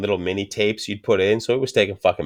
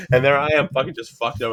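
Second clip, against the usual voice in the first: ordinary delivery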